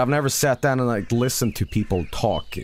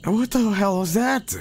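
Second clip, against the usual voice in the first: shaken; 9 semitones higher than usual. Real, not acted. Still shaken after scare.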